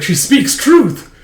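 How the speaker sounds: high, drawn-out, loud pronouncement; grand proclamation. Acted. Odd classmate backs up Anya, grand proclamation. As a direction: proclaim it slowly like a mock oracle. Another student grandly chimes in.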